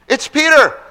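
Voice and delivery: high, loud excited shout; excited announcement. Acted. Servant girl running to tell the saints, excited shout. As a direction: burst in shouting the news.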